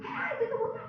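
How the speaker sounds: put-on teacher yelling to stop a child about to fall; sudden yell. Shown as a demonstration. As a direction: call out sharply to stop a child falling. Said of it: "baru saya teriak".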